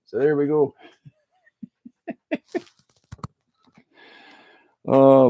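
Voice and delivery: broad, folksy Canadian hoser talk; chatty hoser with a heavy Canadian lilt; Canadian hoser accent. Pretend. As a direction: lay on the Canadian accent thick.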